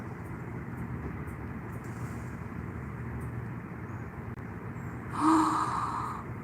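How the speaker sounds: long startled gasp of surprise; a real, drawn-out gasp; sharp gasp. Real, not acted. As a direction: catch your breath in disbelief. Startled by Danny's answer.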